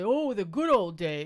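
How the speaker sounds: loud, slow mock nostalgia; hammed-up reminiscing, drawn out and loud; old-days nostalgic. Cohost imitates people missing the past, exaggerated nostalgic voice. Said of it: "They all talk like that".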